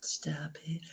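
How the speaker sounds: breathy bashful whisper; whispered stop it. Real, not acted. Host brushing off cohost's compliment, whispered and bashful.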